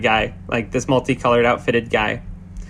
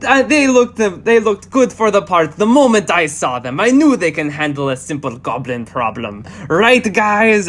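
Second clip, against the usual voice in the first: excited showman voice; 6 semitones higher than usual. Acted. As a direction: brag like a flashy showman. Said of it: "turning to the father is just like".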